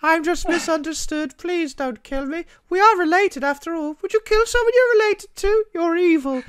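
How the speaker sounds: put-on pleading little boy. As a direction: beg like a small misunderstood child. Michael begging, mocking impression.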